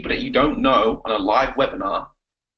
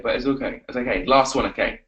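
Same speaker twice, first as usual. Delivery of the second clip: normal talking voice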